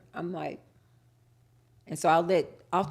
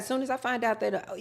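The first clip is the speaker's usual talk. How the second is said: usual speaking voice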